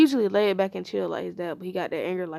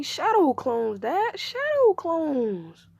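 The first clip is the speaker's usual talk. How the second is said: high, loud, stretched-out reveal; gleeful boast; 7 semitones higher, 3 dB louder, much slower than usual. Acted. Son reveals his secret to Dad, gleeful boast. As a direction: sing out the answer, drawing the last word long.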